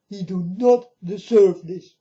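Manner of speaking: normal talking voice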